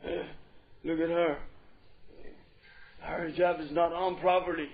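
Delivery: lightly mimicked grumbling brother; low-pitched mock complaint about someone; disapproving brother. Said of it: "brothers always look to this side and say".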